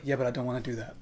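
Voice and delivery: soft, dismissive imitated refusal. Imitating director refusing to loop room tone, softly dismissive. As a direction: drop the volume and decline offhandedly. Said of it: "That's exactly how he said it".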